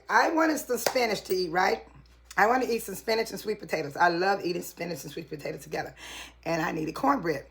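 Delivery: ordinary talk, nothing marked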